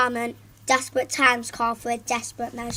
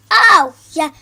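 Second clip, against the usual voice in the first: loud higher yelp; loud outburst; 4 semitones higher, 13 dB louder than usual. Acted. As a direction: yelp it startled. Andrew startled outburst.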